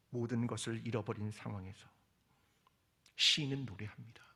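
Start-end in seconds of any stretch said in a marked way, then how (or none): none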